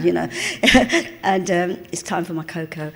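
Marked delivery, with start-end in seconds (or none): 0.3-1.2 rising amused giggle; laugh. Real, not acted. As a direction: laugh at yourself. Laughs at herself.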